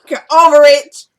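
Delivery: loud, high-pitched real retort; defiant retort. Real, not acted. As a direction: belt it out at the audience. Snapping at viewers over her emotion.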